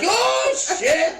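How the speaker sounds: very high mock-frightened exclamation; imitated scared cry. Grandma scared when Happy leaves, imitated frightened cry. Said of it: "she's so scared, like".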